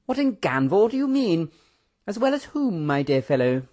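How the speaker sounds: high, swooping bewilderment; baffled exclamation. A narrator's reading. Professor questioning Mister Tando, baffled and excitable. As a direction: demand an answer in high-pitched astonishment. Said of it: "exclaimed the Professor".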